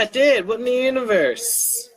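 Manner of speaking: usual speaking voice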